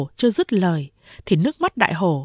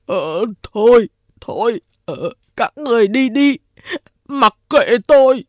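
higher, louder sobbing plea; crying while talking; 3 semitones higher, 5 dB louder than usual. A narrator's reading. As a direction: blubber the words out. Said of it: "Đại Hổ vừa khóc vừa nói".

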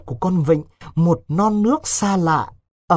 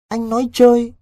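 disbelief; 8 semitones higher, 5 dB louder than usual. A narrator's reading. Vịnh refuses to believe the news.

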